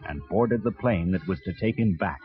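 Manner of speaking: ordinary delivery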